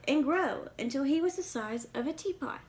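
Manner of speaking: put-on lively read-aloud for kids; storybook reading. A narrator's reading. Reading a picture book to young listeners, lively storytelling.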